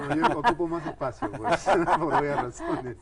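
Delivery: laughing at own joke. Real, not acted. Self-deprecating joke.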